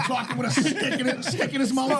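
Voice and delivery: high laughing voice. Real, not acted. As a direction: talk through laughter. Laughing jab about the cigar.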